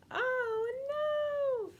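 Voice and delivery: big high squeal of mock surprise; mock alarm. Teacher hamming up the fish being eaten. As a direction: gasp it out like a cartoon fright.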